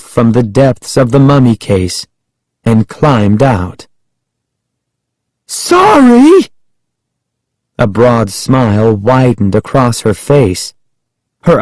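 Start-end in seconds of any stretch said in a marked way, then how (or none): 5.5-6.5 shrill, high-pitched cry of her name; high-pitched shout. A narrator's reading. Frightened kid catches cousin climbing out of the mummy case, high-pitched shout. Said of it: "shout in a high-pitched voice".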